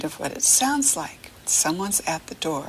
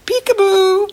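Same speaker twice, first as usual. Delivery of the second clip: much higher, louder sing-song peekaboo; playful peekaboo; 14 semitones higher, 7 dB louder than usual. Acted. Teacher plays peekaboo with the baby, playful call. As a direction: call it out to make a baby giggle.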